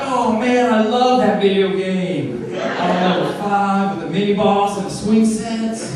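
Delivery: put-on loud, slow gushing kid voice; excited kid. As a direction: gush like a kid about a game. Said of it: "they're like".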